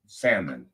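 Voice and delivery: salmon said as SAM-mon; silent L. Shown as a demonstration. Showing his wife's way, salmon with silent L. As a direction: say salmon without any L. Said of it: "my wife pronounced it".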